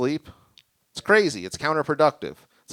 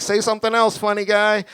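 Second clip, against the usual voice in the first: higher, playful imitated woman; teasing woman; 9 semitones higher, 4 dB louder than usual. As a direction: tease him like a flirty stranger.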